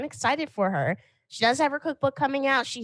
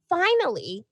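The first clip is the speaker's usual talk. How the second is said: mock exasperated; 7 semitones higher than usual. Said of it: "people are like".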